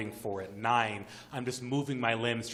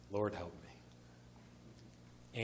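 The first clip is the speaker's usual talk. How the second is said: whispered, drawn-out prayer; barely audible plea for help; hushed plea; 3 semitones lower, 12 dB quieter, much slower than usual. Retold or imitated. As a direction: breathe it out slowly under your breath. Frightened silent prayer amid gunfire.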